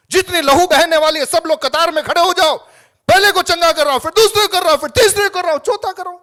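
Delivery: mocking healer. Mocking healer calling lines.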